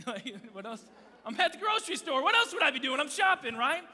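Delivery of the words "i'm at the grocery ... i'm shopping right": higher, louder, mock-indignant; exasperated outburst. Real, not acted. Pastor protesting to the congregation, loud and indignant. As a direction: throw it out to the crowd in disbelief.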